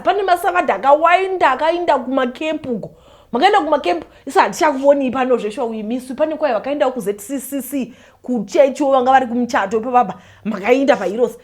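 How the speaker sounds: ordinary talk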